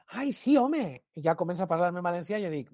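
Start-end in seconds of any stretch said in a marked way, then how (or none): 0.1-1.0 put-on much higher, gushing older lady voice; excited lady. Lady in the metro recognising him, high gushing voice. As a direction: squeal it like a delighted fan spotting him. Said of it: "señora speaking".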